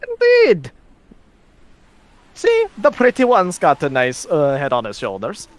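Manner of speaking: high, amused woman voice; teasing and pleased. Acted.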